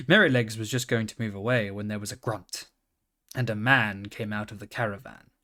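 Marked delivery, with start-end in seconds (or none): none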